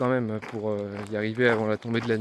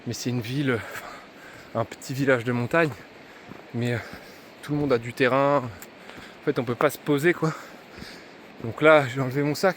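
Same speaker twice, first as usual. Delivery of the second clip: ordinary talk, nothing marked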